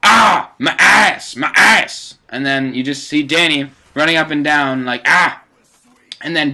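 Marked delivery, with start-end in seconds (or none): 0.0-2.2 loud, high mock scream of pain; imitated screaming. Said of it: "you just hear this screaming". 5.1-5.4 short, high mock scream of pain; loud acted yelp of someone scalded; imitated scream. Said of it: "you just hear this screaming".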